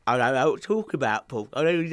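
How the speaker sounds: ordinary talk, nothing marked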